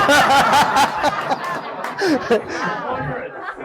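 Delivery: a burst of high, real laughter; delighted high laugh. Real, not acted. Laughs about Yamaha vs horse.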